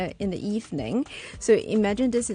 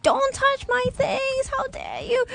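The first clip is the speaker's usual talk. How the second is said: mock scream. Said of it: "They'll be screaming like".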